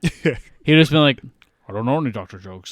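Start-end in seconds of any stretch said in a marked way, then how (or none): 1.7-2.7 lower, quick Scottish-accented impression; Sean Connery. Retelling Bond without the fake accent, mock Scottish voice. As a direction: say it like a Scottish screen spy. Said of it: "if Connery was doing this".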